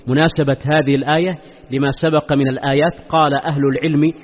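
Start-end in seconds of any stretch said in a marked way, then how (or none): none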